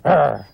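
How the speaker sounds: short loud mock growl; mother dog growl. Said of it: "the word that mother dog uses".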